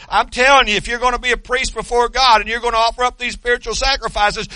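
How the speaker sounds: normal talking voice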